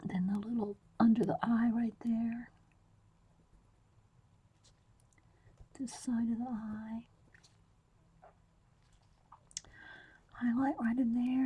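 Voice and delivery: soft whisper, close to the mic. Real, not acted. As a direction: whisper as you draw. Whispers while drawing.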